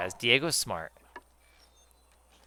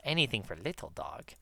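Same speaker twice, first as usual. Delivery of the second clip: quieter, almost to herself; soft aside; 10 dB quieter than usual. Retold or imitated. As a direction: murmur it softly. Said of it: "That's what I say to Diego a lot".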